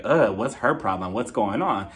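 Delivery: low, disdainful mock aside; judgy. Imitating women judging a friend, judgy. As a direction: look down on her as you say it. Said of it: "looking down on you like".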